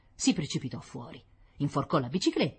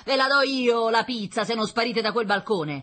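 loud, raised angry threat; threatening father; 9 semitones higher, 6 dB louder than usual. Acted. Father driving children off the balcony, threatening. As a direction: threaten them loudly.

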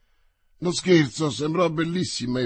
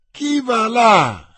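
slow, much higher and louder impatient shout; impatient repeat; 9 semitones higher, 11 dB louder, much slower than usual. Acted. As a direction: demand an answer, losing patience. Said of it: "ripeté la stessa voce spazientita". (urlo lento e spazientito)

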